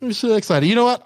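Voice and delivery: sarcastic little imitation; mock excitement. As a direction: parrot a friend's fake enthusiasm. Mimicking the guest's unexcited reply.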